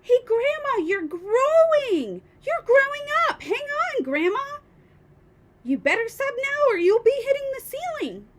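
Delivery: put-on high, loud boy's cry of alarm; alarmed shout. A narrator's reading. George to Grandma as she grows, alarmed shouting. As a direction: shout up in panic at growing Grandma. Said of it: "George gave a jump and shouted".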